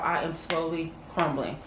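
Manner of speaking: usual speaking voice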